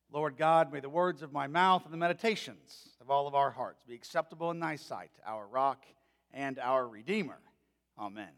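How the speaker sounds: measured, reverent prayer voice; a pulpit prayer before the sermon; spoken prayer. Acted.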